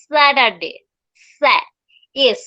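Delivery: "Saturday" in a clear American accent. Shown as a demonstration. Teacher modelling American Saturday for learners. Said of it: "আমেরিকানটা pronunciation follow করি".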